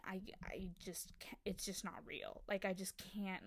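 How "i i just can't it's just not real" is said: breathy whispered aside; whispering. Real, not acted. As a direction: drop to a whisper of disbelief. Aside about too-hot cast.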